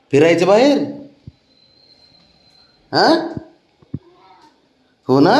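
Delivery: high sing-song coaxing voice for a pet; pet baby talk. Acted. Owner coaxing sulking dog Babdya, high sing-song baby talk.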